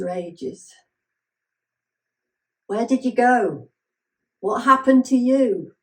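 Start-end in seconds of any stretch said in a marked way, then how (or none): 2.7-5.7 loud, higher, demanding questions; younger self calling out. Acted. Carefree eighteen-year-old self confronting her older self, loud questioning. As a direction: cry out in raised, insistent questioning. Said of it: "Sometimes heard her".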